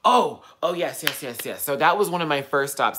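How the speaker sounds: light up at a comment; excited. Real, not acted. Reacting to Rafiq's live comment, loud and excited. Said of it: "You totally reminded me".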